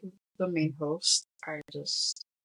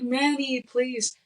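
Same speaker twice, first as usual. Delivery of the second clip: higher, louder imitation of a plea; pleading; 5 semitones higher, 4 dB louder, slower than usual. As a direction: beg him, acting out her desperation. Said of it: "she's pleading with him".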